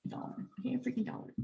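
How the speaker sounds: ordinary delivery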